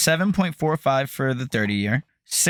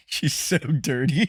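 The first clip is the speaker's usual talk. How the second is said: laughing; 8 semitones higher, slower than usual. Real, not acted. Laughing at dirty dog.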